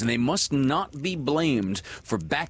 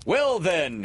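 higher, proud cry; 6 semitones higher than usual. A narrator's reading. Peter readies his big reveal, crying out. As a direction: cry it out, pitched up. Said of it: "he cried".

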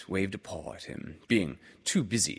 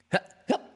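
hiccups; 4 dB louder than usual. A narrator's reading. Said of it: "said Roo again".

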